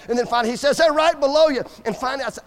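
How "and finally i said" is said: usual speaking voice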